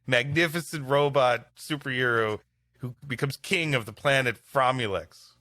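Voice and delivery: ordinary delivery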